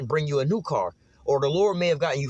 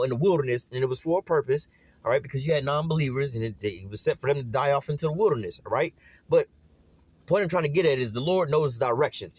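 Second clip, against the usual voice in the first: ordinary talk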